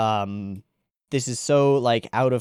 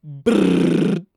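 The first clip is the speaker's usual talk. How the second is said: loud buzzing lip trill; vibration buzz; 4 semitones higher, 6 dB louder than usual. Shown as a demonstration. Imitating a vibrating hand again.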